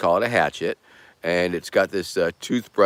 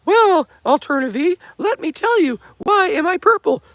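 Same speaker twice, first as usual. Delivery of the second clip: squeaky pipe voice; 4 dB louder than usual. Pretend. Pipe character speaks again.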